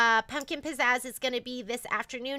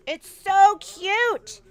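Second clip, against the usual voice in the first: loud, high, drawn-out delight; gushing; 7 semitones higher, 9 dB louder, much slower than usual. Real, not acted. Host showing off a little witch's hat, gushing. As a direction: stretch it out and gush.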